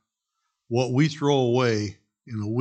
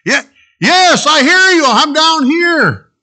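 high, loud yelling reply; desperate shouting; 13 dB louder, slower than usual. Acted. Jack yelling up to the voice from below, frantic shout. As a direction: shout back up the cliff, frantic.

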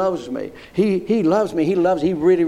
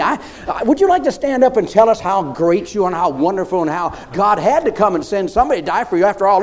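ordinary delivery

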